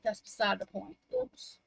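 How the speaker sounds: ordinary talk, nothing marked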